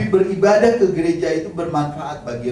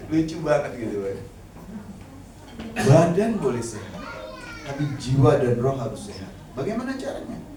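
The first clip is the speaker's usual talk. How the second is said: ordinary delivery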